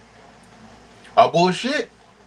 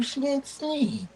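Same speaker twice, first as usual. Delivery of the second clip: mock soft boast; 11 semitones higher, 10 dB quieter, much slower than usual. Mocking line, put-on voice.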